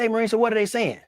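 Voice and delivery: cheerful high-pitched President impression; imitating the President. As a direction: put on a folksy high presidential voice. Retelling the President's lines.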